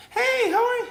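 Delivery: falsetto sing-song "Hey, how are you?"; mock falsetto customer greeting; high-pitched feminine greeting. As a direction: jump way up into a squeaky service voice. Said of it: "high-pitched feminine "hey".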